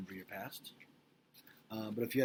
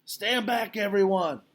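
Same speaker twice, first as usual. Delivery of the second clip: high, loud bark of a yell; 10 semitones higher, 13 dB louder, slower than usual. Acted. Mac yelling at everyone to stand back, loud. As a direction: shout to clear the crowd. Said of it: "Mac yells".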